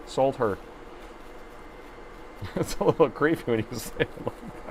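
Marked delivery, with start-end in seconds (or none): 0.1-0.6 "Solder" said sold-er, with a clear L and a long o; "Solder" as "sold-her", L fully sounded; british solder. Shown as a demonstration. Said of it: "Not solder".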